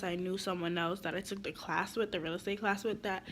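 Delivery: ordinary talk, nothing marked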